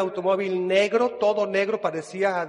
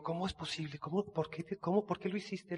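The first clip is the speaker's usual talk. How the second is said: hushed disbelief; 14 dB quieter than usual. Retold or imitated. Asking stepdad, hushed.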